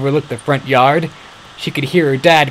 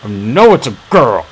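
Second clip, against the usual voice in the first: scornful put-down, louder; sneering; 6 dB louder than usual. A narrator's reading. Saïx sneering at Axel who states the obvious. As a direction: sneer it at his friend. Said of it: "sneered Saïx".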